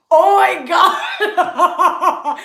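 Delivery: high, loud and very drawn out, laughing through it; overwhelmed with delight at his wife, laughing; laughing in awe. Real, not acted. Gushing about wife, laughing.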